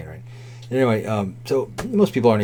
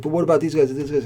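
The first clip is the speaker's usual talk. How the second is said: mildly frantic imitated waitress, higher pitched; mock fretting in a raised pitch; stressed out; 5 semitones higher than usual. Waitress asking the cook about other tables, stressed out. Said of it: "she's, like, fucking stressing the fuck out".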